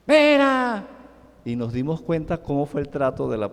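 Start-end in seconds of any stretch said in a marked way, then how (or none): 0.1-0.9 sharp raised shout of a name; imitated yell at toys. Little girl mimicking her teacher, yelling at her toys. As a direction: bark the name like a strict teacher. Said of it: "les empezó a gritar".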